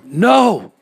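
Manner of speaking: loud, high cry of horror; horrified yell. A narrator's reading. Narrator seeing an eyeball in their arm, horrified yell. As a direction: cry out in shocked refusal.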